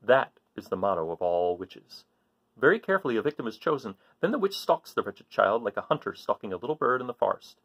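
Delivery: put-on lively read-aloud to children; story reading. A narrator's reading. Teacher reading to kids, lively storytelling voice.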